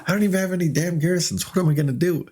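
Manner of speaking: high, hurried flustered complaint; quick, high-pitched retold panic; mock panic. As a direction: rattle it off as comic helplessness. Retells panic, no garrisons.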